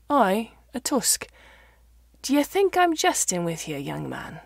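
a hint of a blunt Dales farmer teasing the young vet; mild Yorkshire farmer voice.

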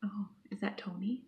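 soft whispered question; whispering. Real, not acted. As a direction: whisper it so nobody hears. Mom films quietly, then calls out.

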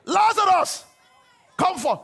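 huge high shout; commanding bellow; loud cry. Acted. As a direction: call the dead out of the tomb. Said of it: "he cried with a loud voice".

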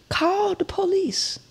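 a breathy whispered urging. Retold or imitated. Telling him to call the police, whispered. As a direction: whisper the order urgently.

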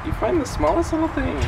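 high cooing voice. Real, not acted. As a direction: coo at the little one. Cooing to baby.

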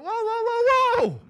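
loud, high drawn-out whoa. Retold or imitated. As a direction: stretch it out to halt the cursing. Kids start swearing.

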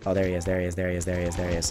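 low, very soft repeated murmur; hushed sighting. Real, not acted. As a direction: murmur it under your breath as you take aim. Spots enemy, lines up shot.

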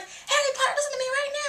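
high-pitched, insistent mock demand; Luna Lovegood. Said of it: "she's like".

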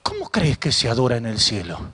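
very low, probing question; solemn voice of God. Retold or imitated. God gently questioning the preacher. (pregunta solemne de Dios, en voz grave)

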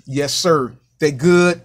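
ordinary delivery

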